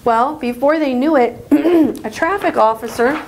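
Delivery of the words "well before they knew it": ordinary delivery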